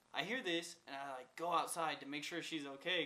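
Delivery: ordinary delivery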